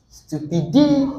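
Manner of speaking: stupi-DI-ty, DI held high; stress on third syllable. Shown as a demonstration. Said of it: "stress every single syllable here".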